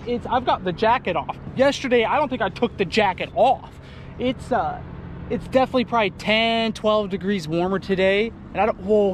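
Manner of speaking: ordinary talk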